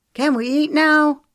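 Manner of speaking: put-on hungry teen fed up with waiting; exasperated. A narrator's reading. Teenage boy whining at the adults about dinner, exasperated. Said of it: "asked in exasperation".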